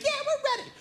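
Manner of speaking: put-on very high squeaky kid cheer; squealing child hype; excited little kids.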